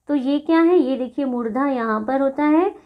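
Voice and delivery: ordinary talk, nothing marked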